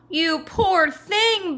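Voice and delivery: booming giantess. Acted. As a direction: stretch out the pity like a big gentle giantess. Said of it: "boomed the giantess".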